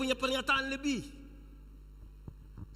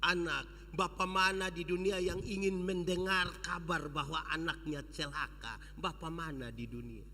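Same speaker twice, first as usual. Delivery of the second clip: low, soft comforting father; tender father; 6 semitones lower, 6 dB quieter than usual. Acted. Father comforting his son, soft and tender. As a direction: stroke the child's head with the voice. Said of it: "dia pun bapa bilang begini".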